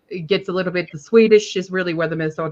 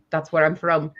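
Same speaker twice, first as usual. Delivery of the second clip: normal talking voice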